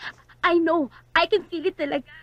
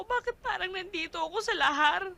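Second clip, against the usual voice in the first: ordinary talk, nothing marked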